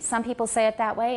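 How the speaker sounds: ordinary delivery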